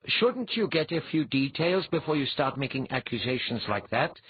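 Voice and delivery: usual speaking voice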